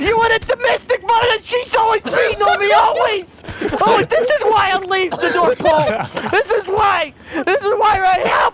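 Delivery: high, loudening wailed complaint; hammed-up marital outburst. Santa storming out on his wife, hammed-up anguish. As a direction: wail the grievance like a melodrama husband.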